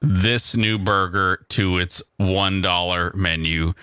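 slow, low, unenthused announcement; mock-unexcited. Real, not acted. Host announces a new cheap burger to co-host, unexcited. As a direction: announce it with no excitement at all. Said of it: "don't sound so excited".

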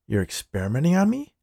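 taken aback, pitch rising sharply; incredulous question. Acted. New guy learns he's a test subject, incredulous. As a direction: voice climbing high into the question. Narrator acts alarmed question to boss.

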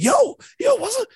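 high-pitched, loud re-enacted yell; retold excited scream. Host re-enacting spotting Garnett on TV as a boy, screaming. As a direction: yelp it sky-high, imitating your younger self. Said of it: "I start screaming".

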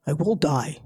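a hint of a low-voiced father; lightly voiced father. Father answering his daughter's diet plea, lightly voiced. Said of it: "And he said".

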